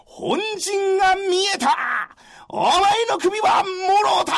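high, loud war cry; battle shout. Acted. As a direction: roar it as you charge. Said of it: "真田幸村叫びましたが".

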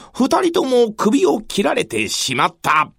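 ordinary delivery

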